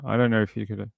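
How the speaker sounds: ordinary talk, nothing marked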